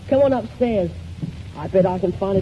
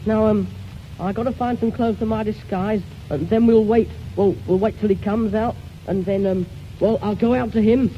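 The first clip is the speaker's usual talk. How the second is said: ordinary talk, nothing marked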